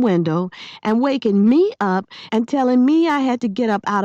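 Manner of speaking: angry lecture. A narrator's reading. Amanda lecturing Maniac angrily.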